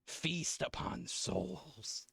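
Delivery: lightly voiced ominous demon god; underworld god. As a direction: speak as a dark god, slow on feast. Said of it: "as if the voice of an underworld god".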